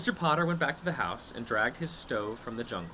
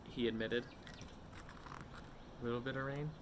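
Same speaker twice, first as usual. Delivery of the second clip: plain reading voice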